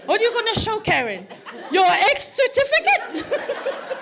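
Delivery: high, incredulous motherly telling-off; mock scolding. Mother scolding son, retold.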